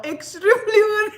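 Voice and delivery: high, loud, laughing repeat; incredulous words dissolving into laughter; breaking into laughter. Real, not acted. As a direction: crack up as you repeat it. Breaking up over minister's claim.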